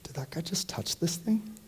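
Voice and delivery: hushed, scandalized whisper; a bearer whispering in disbelief; shocked whisper. Retold or imitated. Bearers shocked at Jesus touching bier.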